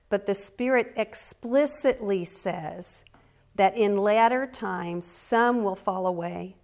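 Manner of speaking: emphatic scripture reading. A narrator's reading. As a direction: drive each warning verse home. Reads warning verses with emphasis.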